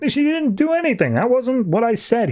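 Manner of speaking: high-pitched, exasperated retelling; hammed-up objection, pitched well up; mock protest. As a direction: act out an indignant denial. Retold quarrel, protesting character.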